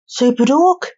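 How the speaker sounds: high, rising incredulous question; surprised upward swoop at the end; disbelieving. A narrator's reading. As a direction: ask it as if unable to believe your eyes. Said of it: "not believing his eyes".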